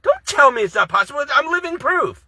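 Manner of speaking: indignant. Real, not acted. Rebutting doubters angrily.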